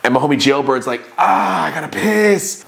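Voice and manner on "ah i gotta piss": urgent pee plea. Retold or imitated. Buddy pleading to pee.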